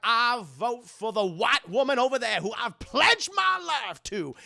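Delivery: loud, high-pitched declamation; hammed-up rousing pledge; Charles Dutton. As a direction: proclaim it grandly in a put-on voice. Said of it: "Charles Dutton's like".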